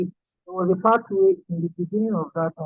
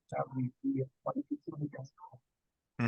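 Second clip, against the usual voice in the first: very soft, fading far under usual volume; hard to hear; 4 semitones lower, 13 dB quieter than usual. Real, not acted. Student answering the teacher on a call, barely audible. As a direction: say it too quietly to be heard. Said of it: "I'm finding it hard to hear you".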